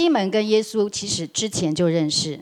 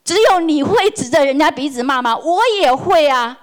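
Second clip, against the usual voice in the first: louder, higher heated comeback, slowing at the end; imagined angry retort; 7 semitones higher, 6 dB louder than usual. Retold or imitated. Employee imagining talking back to the boss, heated defiant retort. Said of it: "我很想跟他讲说".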